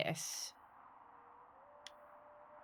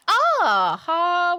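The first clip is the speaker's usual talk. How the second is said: excited discovery; 9 semitones higher, 15 dB louder than usual. Real, not acted. Finds the telescope.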